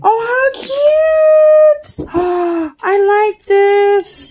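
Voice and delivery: high squealing gasp of delight, slow and stretched. Real, not acted. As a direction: squeal over a cute gift. Opens gift, squeals.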